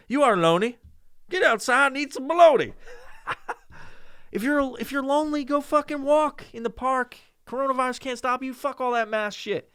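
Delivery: high, loud hyped-up pep talk; excited rant. Acted. Urging lonely listeners to go outside, high excited rant. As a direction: sell it like an overexcited friend.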